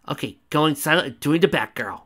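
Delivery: ordinary delivery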